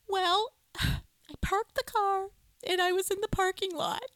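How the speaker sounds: a light take on a tearful mom; Joanne impression. Mom starts the seagull story, sighing mom voice. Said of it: "your Joanne impression".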